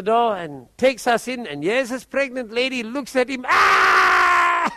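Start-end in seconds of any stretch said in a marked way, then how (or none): 3.5-4.7 sudden high scream of fright; frightened scream. Retold or imitated. Possessed pregnant woman sees the preacher, frightened high scream. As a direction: shriek as if seized by terror. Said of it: "I got the fright of my life".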